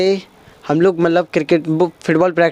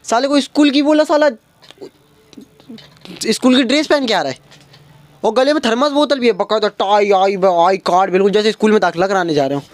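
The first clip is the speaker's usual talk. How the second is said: exasperated disbelief; 4 semitones higher than usual. Retold or imitated. Exasperated at overdressed friend.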